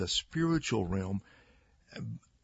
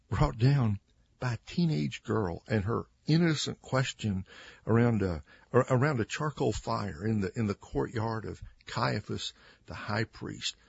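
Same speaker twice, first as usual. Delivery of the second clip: ordinary talk, nothing marked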